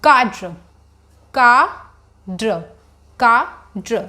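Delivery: Cadre said as "काड्र", slow and careful; pronunciation demo. Shown as a demonstration. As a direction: spell out "काड्र" slowly, kaa-dra, rolled r. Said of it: "how do we pronounce this word?".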